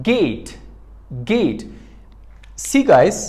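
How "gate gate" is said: Gate with a hard g as in go. Shown as a demonstration. Teacher shows an exception to the class, hard g in Gate. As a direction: say Gate with a hard opening g, not j. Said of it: "it has the sound of".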